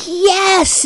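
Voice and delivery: high triumphant yes; excited yes. Retold or imitated. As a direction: cheer like he just won. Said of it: "And he was like".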